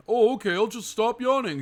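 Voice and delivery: high, loud sarcastic put-on voice; mock the idea in a silly high voice; sarcastic mock reply. Mocking people who say stop yawning.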